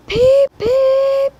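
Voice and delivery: very high, loud piping whistle; engine whistle. A narrator's reading. Thomas arrives late, a piercing high whistle. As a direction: toot like a little tank engine. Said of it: "whistled Thomas".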